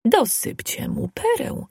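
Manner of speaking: put-on high, hushed old woman's voice; a lightly voiced granny, soft; old granny insisting. A narrator's reading. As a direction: repeat it calmly like a wise old granny. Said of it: "Raz jeszcze rzecze".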